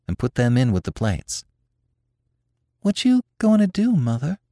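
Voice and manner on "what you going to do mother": high, timid question; small timid daughter's voice. A narrator's reading. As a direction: ask mother hesitantly in a high voice. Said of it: "in a timid voice".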